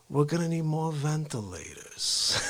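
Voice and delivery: slow, softened New York governor impression; Cuomo. Mimicking how the governor says ventilators, laid-back impression. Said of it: "I love how he says ventilators".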